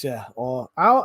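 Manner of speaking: ordinary delivery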